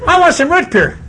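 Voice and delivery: put-on high, whiny child demanding; whiny kid. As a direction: whine like a spoiled boy. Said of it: "You know".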